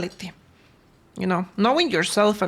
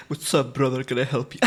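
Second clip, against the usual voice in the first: much lower, laughing tough greeting; low mock-macho with laughs; deep bro voice; 8 semitones lower, 3 dB quieter than usual. Shown as a demonstration. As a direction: play the big guy and cover the giggle. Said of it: "pretend to use that skill".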